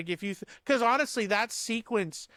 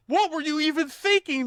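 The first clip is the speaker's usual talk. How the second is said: high-pitched mock outburst, louder; mock-angry scolding, pitched way up; angry Maverick; 10 semitones higher, 4 dB louder than usual. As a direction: squeal it like a scolding pilot. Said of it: "then he's like".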